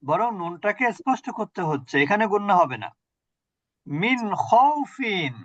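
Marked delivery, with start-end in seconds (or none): 3.9-5.3 মিন খাউফিন slowly, with the ন of মিন clear before খ; clear nun recitation. Shown as a demonstration. Teacher slowly reciting a clear nun for the student. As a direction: draw out মিন খাউফিন, the n fully sounded. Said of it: "নুন সাকিনের পরে খ আসছে".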